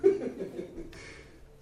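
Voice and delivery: trailing breathy chuckle; airy laugh. Real, not acted. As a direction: let the laugh fade out. Laughter trailing after the joke.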